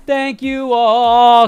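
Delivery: high, loud, drawn-out call; big announcer-style holler, stretched long; shouted sign-off. Real, not acted. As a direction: belt out a slow farewell to the crowd.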